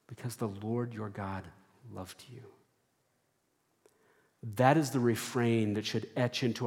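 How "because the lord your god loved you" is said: much softer, fading repeat; hushed echo. Shown as a demonstration. Preacher softly repeating the verse to the church, hushed. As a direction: let the words sink in quietly.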